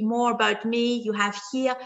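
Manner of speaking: ordinary delivery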